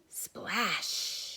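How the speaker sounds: usual speaking voice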